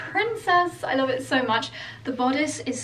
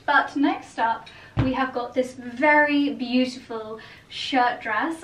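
ordinary delivery

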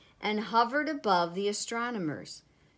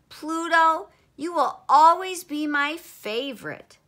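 high, loud earnest declaration; child's voice; 7 semitones higher, 8 dB louder than usual. A narrator's reading. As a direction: speak up like a devoted child. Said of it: "the child said".

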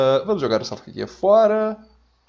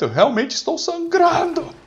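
hammed-up desperate wail; mock panic; 4 dB louder than usual. Gamer playing a soldier sure he will die, mock panic. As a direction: voice shooting up high on the last word.

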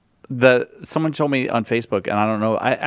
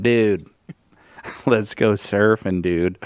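put-on slow surfer-dude Californian accent; mock Californian accent; 3 semitones lower, much slower than usual. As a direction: imitate a Californian surfer, dropping low on the last word. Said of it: "Californians in Hawaii going".

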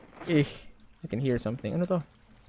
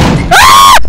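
terrified scream; 8 semitones lower, 23 dB louder than usual. Real, not acted. Player cut off mid-complaint by a scare, terrified scream. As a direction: scream in sudden terror.

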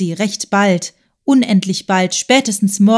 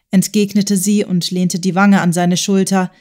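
plain reading voice

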